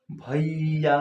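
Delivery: भैया stretched out with a long final vowel; drawn-out bhaiya. Shown as a demonstration. Teacher showing a pluta vowel in भैया, stretched out long. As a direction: draw out भैया as if calling someone. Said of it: "अगर भैया को हम खींच कर बोलेंगे ना".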